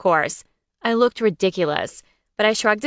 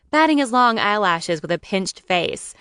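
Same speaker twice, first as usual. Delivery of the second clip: plain reading voice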